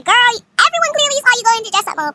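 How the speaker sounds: high, loud comeback; exasperated retort. Retold or imitated. Acted comeback in the argument.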